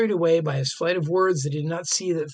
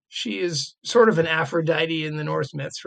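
normal talking voice

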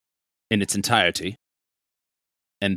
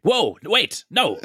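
alarmed; 10 semitones higher, 5 dB louder, much slower than usual. Acted. Player panics at dispel.

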